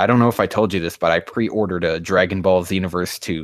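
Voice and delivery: normal talking voice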